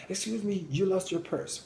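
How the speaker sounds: put-on a high, polite child's voice; lightly voiced child returning the purse; polite kid.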